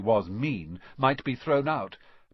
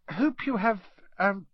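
put-on higher, hesitant girl's voice; uncertain Hermione; 8 semitones higher, 3 dB louder than usual. A narrator's reading. Hermione hesitantly wishes Harry a good holiday. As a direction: wish it, unsure, eyeing him. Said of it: "looking uncertainly after Uncle Vernon".

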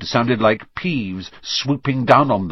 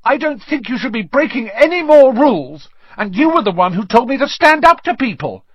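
high-pitched, indignant schoolboy standing his ground; Neville, timid but defiant; 12 semitones higher, 5 dB louder than usual. A narrator's reading. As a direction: protest in a high, strained voice.

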